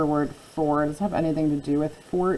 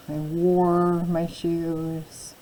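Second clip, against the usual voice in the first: slow teaching voice; much slower than usual. Shown as a demonstration. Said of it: "let's try out our headers".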